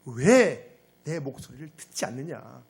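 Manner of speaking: slow, emphatic divine question; God's reproach. Acted. God questioning Israel's disobedience, slow reproachful demand. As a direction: lean hard on the opening "why", drawn out. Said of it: "이 모습을 보고 하나님이 말씀하십니다".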